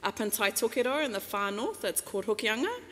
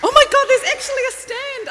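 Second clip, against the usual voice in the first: high, loud excited exclaim; squealing with real surprise; delighted surprise; 15 dB louder than usual. Real, not acted. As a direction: burst out, thrilled to find it. Surprised to find a mic stand.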